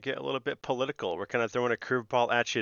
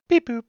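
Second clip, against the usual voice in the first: beep boop; 11 dB louder than usual. Pretend. Robot sign-off noise.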